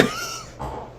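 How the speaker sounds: high giggle trailing off; breathy high laugh. Real, not acted. Streamer laughing at a second sign, high giggle. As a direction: giggle in disbelief.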